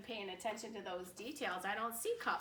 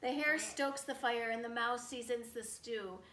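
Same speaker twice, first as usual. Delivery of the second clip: rhyming story narration; 5 dB louder, much slower than usual. A narrator's reading. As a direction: lift the rhyme toward the bear's lair.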